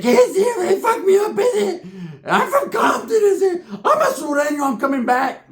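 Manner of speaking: very high, tearful mock squeal; mock wailing. Beaten Pepe held up while fleeing, high mock wailing. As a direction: imitate a beaten man wailing defiant threats.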